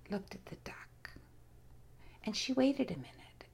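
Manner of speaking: usual speaking voice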